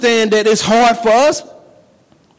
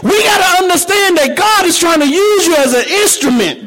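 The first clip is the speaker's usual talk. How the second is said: preaching; 6 semitones higher than usual. Acted. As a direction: preach it with rising fire. Preaching at full force.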